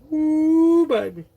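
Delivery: joking hype over his own hair; whooping woo. Real, not acted. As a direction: whoop it up at the mirror.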